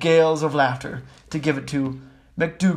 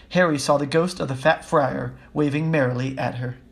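plain reading voice

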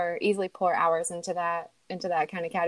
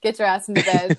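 bossy cat voice; 7 dB louder than usual. Pretend. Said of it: "I hate when Mona puts you to".

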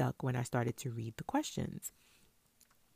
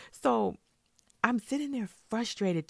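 ordinary delivery

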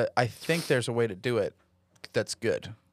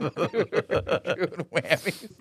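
laughing; 3 semitones higher, much slower than usual. Real, not acted. Laughing after the bit.

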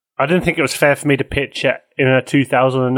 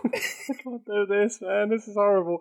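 laughing; 5 semitones higher, 9 dB quieter than usual. Real, not acted. Breaking up mid pitch.

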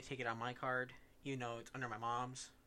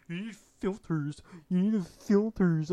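mock lecture; 4 semitones higher, 11 dB louder, slower than usual. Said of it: "I was like".

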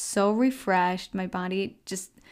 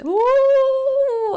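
high, loud startled "ooh" at icy water; cold shock yelp; 11 dB louder than usual. Retold or imitated. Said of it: "when we get cold, you know, it's".

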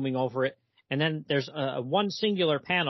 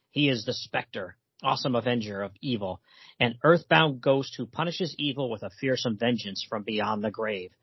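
lower, dramatic cartoon-intro narration; deep announcer read; 5 semitones lower than usual. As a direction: read it like an ominous show opener voice-over. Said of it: "somebody, you know, uh, like either Hitchcock or, uh, somebody reading this".

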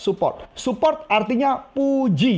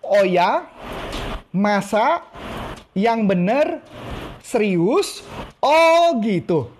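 interested surprise again; much slower than usual. Shown as a demonstration. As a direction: act fascinated with each word. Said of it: "Ulang lagi ya".

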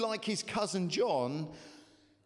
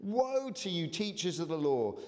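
impassioned scripture reading; 4 semitones higher than usual. Acted. Preaching Jesus' woes.

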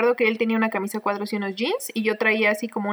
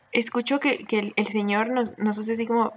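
ordinary talk

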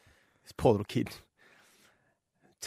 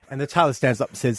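normal talking voice

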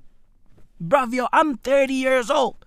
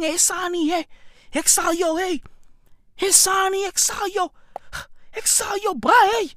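imitating prisoners calling; 4 semitones higher, 7 dB quieter than usual. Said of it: "motherfuckers are out there going".

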